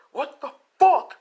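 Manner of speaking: high, very loud enraged shout; acted furious outburst. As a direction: yell at the caller in outrage. Izuku explodes over cameras.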